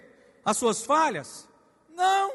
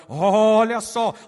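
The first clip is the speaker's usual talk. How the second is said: slow and louder; drawn-out call; 7 dB louder, much slower than usual. Real, not acted. Pastor to church, slow loud call. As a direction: stretch it to grab attention.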